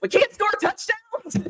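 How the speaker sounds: high, slow mock-whiny fan complaint; mock fan whine. Mock frustrated fan quote.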